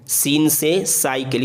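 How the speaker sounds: सीन से साइकिल, slow with a light thin स; thin s demo. Shown as a demonstration. Teacher warning students: a slow सीन से साइकिल with a thin स. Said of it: "इसको साइकिल नहीं पढ़ेंगे".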